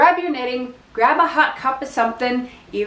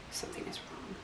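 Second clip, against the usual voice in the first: whisper; 25 dB quieter, faster than usual. Retold or imitated. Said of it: "and I'm like".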